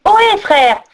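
put-on high boyish call. A narrator's reading. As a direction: hail your brothers from afar. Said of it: "cria le jeune pêcheur".

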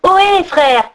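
put-on high boyish call. A narrator's reading. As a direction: hail your brothers from afar. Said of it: "cria le jeune pêcheur".

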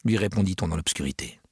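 ordinary delivery

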